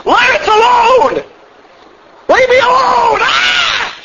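very high, drawn-out mock-possessed scream; high sustained yell ending in a shriek; crying out loud. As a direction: wail it out like a man possessed. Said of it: "cries out with a loud voice".

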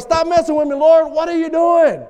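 high, loud acted complaint; exasperated protest. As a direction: challenge God in exasperation. Acts out Job protesting to God.

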